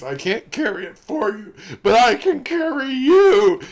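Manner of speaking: high, loud hammed-up crying plea; mock sobbing Sam. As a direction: overplay it, choking back tears. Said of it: "I can't even say it with a straight face".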